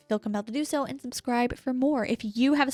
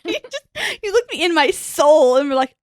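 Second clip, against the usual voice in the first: laughing; 6 semitones higher, 8 dB louder than usual. Real, not acted. Laughing retelling blooper.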